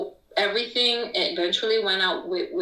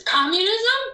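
much higher and louder, rising Communism?; incredulous shout; 11 semitones higher, 5 dB louder than usual. Retold or imitated. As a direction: fling out Communism? in disbelief. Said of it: "I went berserk".

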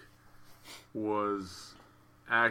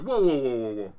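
alarmed whoa; 3 semitones higher, 5 dB louder than usual. Retold or imitated. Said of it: "Conrad's like".

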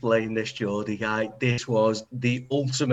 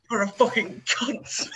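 high, slow mock-posh insult; lightly hammed-up posh English accent; 8 semitones higher, slower than usual. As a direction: imitate a dead posh voice, drawn out. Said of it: "In a dead posh accent".

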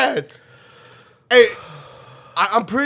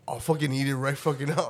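muttered; 9 semitones lower, 9 dB quieter, faster than usual. Retold or imitated. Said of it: "you're like".

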